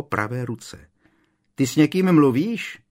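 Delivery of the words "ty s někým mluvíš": loud, high question; raised, projecting query; calling across. A narrator's reading. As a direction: shout the question to someone out of sight. Ron calling through the wall.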